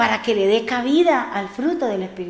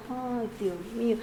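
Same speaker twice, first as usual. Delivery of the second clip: weary sigh; 16 dB quieter than usual. Retold or imitated. Retold despair over ironing.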